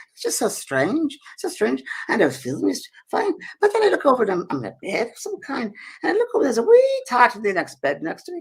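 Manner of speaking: high storytelling in a put-on Scottish accent; Mary, Scottish lady. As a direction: recount it as a puzzled, high-voiced Scottish woman. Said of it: "I'm trying to my Scottish accent".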